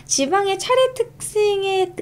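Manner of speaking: 지방의 차례 특징에 said high, slow and slurred; garbled reading. Real, not acted. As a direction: trip over 차례 as it comes out mangled. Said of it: "발음 왜 이래?". (발음이 꼬인 채 높게)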